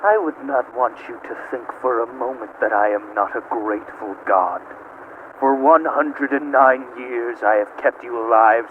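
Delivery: menacing hush. Acted. AM offering Gorister false mercy in a low hush. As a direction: purr the cruelty quietly.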